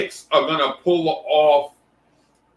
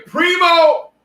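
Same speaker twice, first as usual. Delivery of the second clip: much higher, loud single-name yell; excited call-out; 13 semitones higher, 5 dB louder than usual. Real, not acted. Streamer spotting a viewer in chat, excited high yell.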